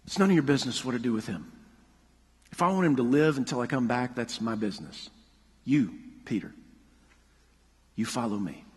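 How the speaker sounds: Jesus gently correcting Peter, low and firm. Retold or imitated. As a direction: set him straight calmly and low. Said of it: "And Jesus says to Peter".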